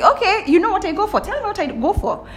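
high, mocking dare; high sarcastic tell me to a know-it-all; taunting challenge. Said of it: "I was like".

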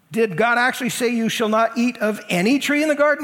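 loud, high, overblown disbelief; exaggerated. Satan twisting God's words to Eve, exaggerated. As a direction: inflate the question to sow doubt. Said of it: "in this exaggerated tone".

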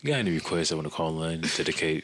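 low, soft radio host purr; quiet storm DJ. Shown as a demonstration. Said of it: "that's how they talk".

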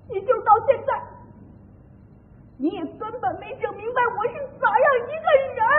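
high-pitched, crying complaint; weepy, wronged lament; tearful reproach. Acted. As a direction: choke out the hurt, voice rising. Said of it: "哭啥呀？".

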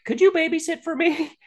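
very high mock woman's voice; imitated woman. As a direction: squeak out a woman's request, hammed-up. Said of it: "where the woman's like".

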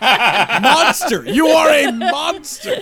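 loud, laughing mock accusation; mock outrage. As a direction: yell it in fake horror. Mock outrage at speed watching.

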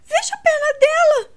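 high, loud panicked cry; horrified cry. A narrator's reading. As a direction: shriek it in horror. Edwina sees broken leg.

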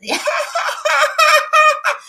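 long high loud mock laughing fit; imitación de risa. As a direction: imitate her endless shrieking laugh. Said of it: "ella empieza a reírse". (imitando una carcajada larguísima y aguda)

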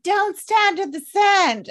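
hammed-up shout of warning from the car; mock warning call. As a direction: call it out as a drawn-out alarm.

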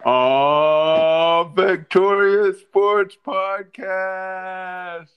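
slow, loud wrestler hype call; Big E intro. Retold or imitated. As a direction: stretch the show name like a wrestling entrance. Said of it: "a Big E New Day intro".

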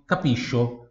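"Capisco" wrongly said ka-PEE-sho; mistaken SH sound. Shown as a demonstration. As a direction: imitate a learner saying "Capisco" with SH for SC. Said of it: "vai ter som de X também".

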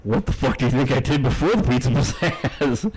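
laughing through the comeback; half-laughing indignant question; laughing retort. Retold or imitated. Retelling the birthday argument with his girlfriend, laughing indignation. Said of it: "I'm like".

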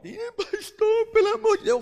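high, begging whine of a church member; pleading member. Retold or imitated. Church member begging the pastor, high whining plea.

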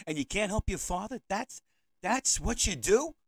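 put-on guilt-trip the kid with rising outrage; exasperated. Pleading with his grown kids.

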